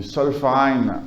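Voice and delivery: Zollverein said with a German accent; German pronunciation of Zollverein; the term said the German way. Shown as a demonstration. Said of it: "excusez mon allemand, mon accent allemand".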